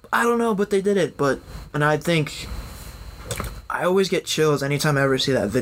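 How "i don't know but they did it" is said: excited. Retold or imitated. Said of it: "We're like".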